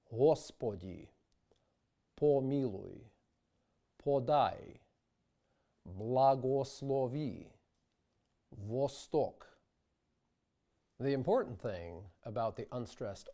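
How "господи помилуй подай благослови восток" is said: every o in Господи, помилуй said round and unreduced; full Church Slavonic o. Shown as a demonstration. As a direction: keep each o as a clear o, no reduction. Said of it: "we transliterate these Slavonic words as follows".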